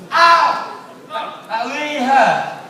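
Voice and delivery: a loud high yelp, then slow rueful complaint; hammed-up pain. Presenter pretending dry ice burnt him, hammed-up ouch. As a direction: play a comic burn for laughs.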